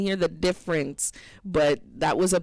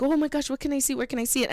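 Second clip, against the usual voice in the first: mimicked eager people; 6 semitones higher, much faster than usual. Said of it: "And people are like".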